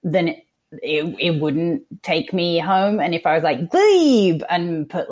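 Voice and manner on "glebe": high, loud Australian drawn-out Glebe; exaggerated Aussie inflection on "Glebe", much louder. Shown as a demonstration. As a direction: hit "Glebe" high and falling, Australian style. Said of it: "put, like, the inflection on it".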